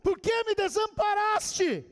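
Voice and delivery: high, drawn-out preacher's cry; Jesus crying out. Acted. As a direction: cry out slowly in abandonment. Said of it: "Quando Jesus gritou na cruz".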